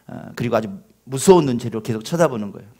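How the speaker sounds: usual speaking voice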